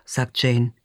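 plain reading voice

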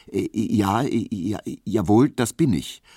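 halting, tripping over the ums; stammering. A narrator's reading. Nervous barber's assistant stammering to the official. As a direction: stumble through the answer nervously. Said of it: "stotterte Emil Kubinke".

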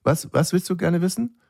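high, coaxing question; prompting parent. Retold or imitated. Parent urges bored son to ask something, high coaxing voice. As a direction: imitate a parent prompting a child.

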